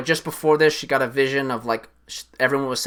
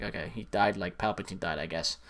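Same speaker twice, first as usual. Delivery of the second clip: deadpan mutter; 7 semitones lower, 13 dB quieter than usual. Retold or imitated. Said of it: "you're like".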